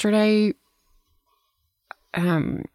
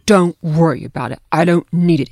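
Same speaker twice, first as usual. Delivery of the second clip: louder, emphatic mimicry of a text reply; insistent brush-off; 7 dB louder than usual. Woman reads out another's texted reply, loudly insistent. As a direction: imitate someone firmly waving off help. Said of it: "she writes back".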